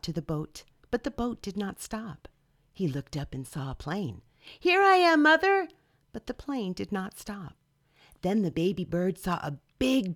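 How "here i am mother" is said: high, loud call across the water. Acted. Baby bird calling to the passing boat, high and loud. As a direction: shout to a boat far away.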